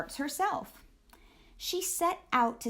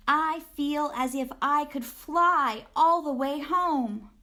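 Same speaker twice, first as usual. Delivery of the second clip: put-on higher, louder, joyful girl's voice; elated child; 6 semitones higher, 5 dB louder than usual. A narrator's reading. Grace after her triumph on stage, elated and higher. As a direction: float it out with delight.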